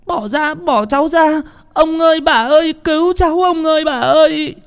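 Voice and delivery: put-on very high, loud child's cry for help. Grandson cries to his grandparents while dragged away, high child's cry. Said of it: "thằng bé kêu lên".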